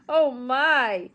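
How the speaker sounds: ordinary delivery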